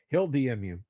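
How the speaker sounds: normal talking voice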